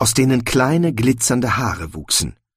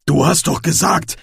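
higher-pitched indignant exclamation; raised, accusing objection; calling out in protest; 6 semitones higher than usual. A narrator's reading. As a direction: call it out as a protest. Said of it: "rief Glöck".

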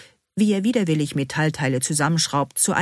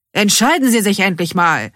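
exasperated demand; 4 semitones higher, 7 dB louder than usual. A narrator's reading. Workman fed up with the customers.

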